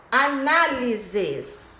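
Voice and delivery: "análises" slow, stressed on NA; drawn-out "análises" with strong second syllable. Shown as a demonstration. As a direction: lean on the NA of "análises". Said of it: "esse na".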